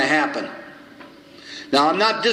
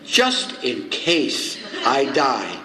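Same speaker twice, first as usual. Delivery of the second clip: very slow, deliberate mock-serious answer; retold answer, word by careful word; pondered answer; 3 semitones lower, much slower than usual. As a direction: draw it out as if thinking it through.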